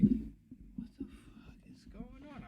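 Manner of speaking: slow bewildered whisper; confused whisper. Retold or imitated. Dazed on the couch hearing voices, slow whisper.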